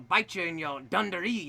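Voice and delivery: normal talking voice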